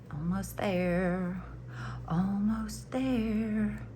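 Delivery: slow, lightly drawn-out film quote; Star Wars quote. Acted. Crocheter nearing the last corner, lightly quoting Star Wars. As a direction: stretch it out like a tense movie line. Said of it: "I was going, "Almost there".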